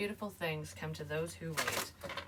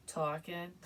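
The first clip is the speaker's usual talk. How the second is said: mimicking a New York "talking"; crap New York accent. Shown as a demonstration. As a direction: echo the word in a rough New York accent. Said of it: "kind of a crap accent".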